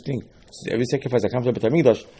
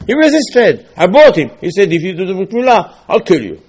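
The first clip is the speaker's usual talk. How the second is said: higher and louder complaint; exasperated owner; 6 semitones higher, 6 dB louder than usual. Acted. Owner explaining his slave's threat, loud and exasperated. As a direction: complain the slave refused, loudly.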